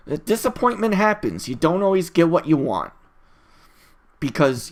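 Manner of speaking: ordinary talk, nothing marked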